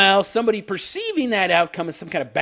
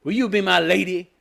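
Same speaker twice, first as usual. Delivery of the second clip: mock Gendry proposal. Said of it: "gets down on one knee and says".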